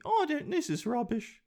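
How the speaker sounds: very high, dismissive British falsetto; the Queen. Acted. Queen dismissing her grandchild's career plea, high mock-regal falsetto. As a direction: brush it off in a high, fluty granny voice. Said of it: "you could go to the queen".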